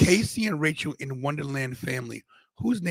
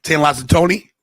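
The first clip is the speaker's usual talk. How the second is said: hype intro; 4 semitones higher, 10 dB louder than usual. Acted.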